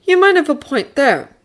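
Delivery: high, grudging admission; admitting. Acted. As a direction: concede it reluctantly. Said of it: "he admitted".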